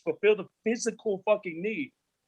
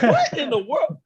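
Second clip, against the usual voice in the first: mock disbelief; 9 semitones higher, 6 dB louder, slower than usual. Mocking peer continues.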